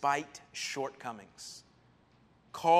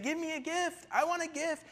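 whiny beggar; 11 semitones higher than usual. Pretend. Mock-quoting a gift-begging person.

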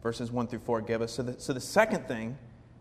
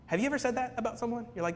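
ordinary talk, nothing marked

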